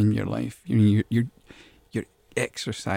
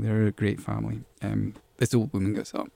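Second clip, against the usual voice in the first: ordinary delivery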